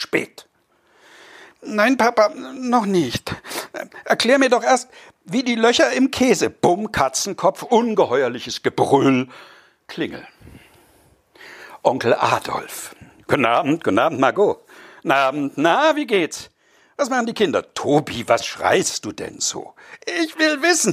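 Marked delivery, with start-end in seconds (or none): 1.6-6.5 put-on high, pleading child's voice that yelps at the shove; a small boy begging to know; whiny little boy. Boy nags his parents at the dinner table, high and whiny. 20.2-20.9 put-on high, pleading child's voice that yelps at the shove; a small boy begging to know; whiny little boy. Boy nags his parents at the dinner table, high and whiny.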